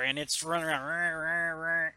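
ordinary talk, nothing marked

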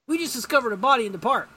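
high, loud, quick announcement; Hawkman. Retold or imitated. Hawkman reporting a body to Superman, urgent.

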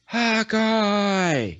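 high, loud drawn-out scream; hammed-up cry for help; acted scream. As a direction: scream the name out in a story. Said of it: "she screams out".

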